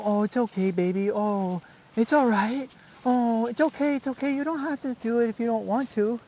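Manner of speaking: mock the over-soothing owner; imitating coddling owner. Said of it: "They go".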